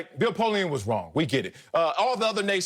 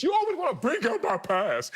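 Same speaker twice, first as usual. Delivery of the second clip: higher-pitched playful complaint; mock protest; 6 semitones higher than usual. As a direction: protest at being teased about the past. Mock-protest at co-host's teasing, amid laughter.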